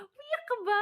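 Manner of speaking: high, loud retold question; startled question. Friend asking if she cried, loudly surprised. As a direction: exclaim it in mock alarm. Friend reacting to her tears.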